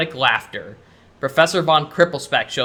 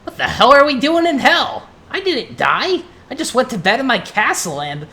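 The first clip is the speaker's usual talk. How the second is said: high-pitched, baffled protest; exclaiming in disbelief; 9 semitones higher, 3 dB louder than usual. Acted. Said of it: "Conker exclaims".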